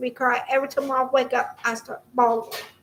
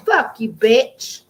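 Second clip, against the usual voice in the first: angry curse; 7 dB louder, slower than usual. Real, not acted. Angry rant at former coworker.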